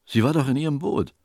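high, quick, urgent reply; alarmed protest. A narrator's reading. Captain insists to helmsman about his wife, high alarm.

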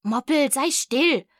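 firm, slowed scolding; hushing the cat. A narrator's reading. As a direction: tell a pet off, drawing it out. Narrator hushes her cat.

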